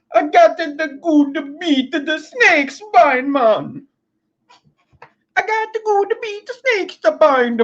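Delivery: sing-song fake Jamaican drawl; mock Jamaican accent. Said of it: "then went to a little Jamaican".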